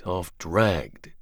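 plain reading voice

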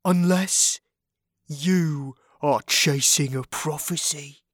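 dramatic reveal in a drawled character voice; pausing for effect. A narrator's reading. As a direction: draw it out so they hang on it. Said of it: "making sure that everyone was hanging on his words".

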